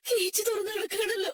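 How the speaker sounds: high-pitched crying lament; sobbing, panicked realisation; tearful dismay. Acted. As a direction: wail in dawning horror. Grandmother finds gate open.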